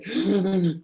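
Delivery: high, sharp gasp mimicking his wife's sob; imitated sob gasp. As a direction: gasp like a woman breaking into tears. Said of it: "se oía así". (jadeo agudo imitando el llanto de su esposa)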